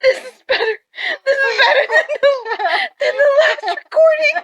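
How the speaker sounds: high laughing cheer. Real, not acted. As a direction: laugh through the words. Laughing while cheering her on.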